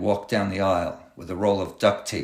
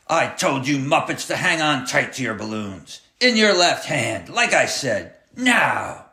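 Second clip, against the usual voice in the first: bellowed; 5 semitones higher than usual. A narrator's reading. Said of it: "he bellowed from the back of the bus".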